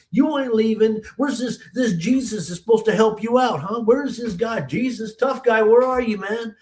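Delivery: high-pitched, heated ranting; mad tantrum. Retold or imitated. Grandson raging at Jesus over his grandmother, mad tantrum. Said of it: "she let me throw my tantrum".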